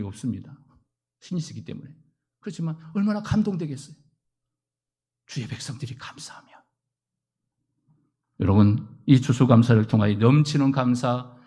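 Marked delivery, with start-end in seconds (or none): 5.3-6.5 hushed unvoiced phrase; whisper. Real, not acted. Preacher hushing on God's people giving thanks. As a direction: drop to a whisper. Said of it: "whispered aside".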